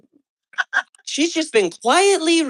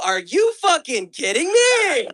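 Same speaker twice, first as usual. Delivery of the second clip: outraged disbelief; 7 semitones higher, slower than usual. Acted. Outraged at the book passage.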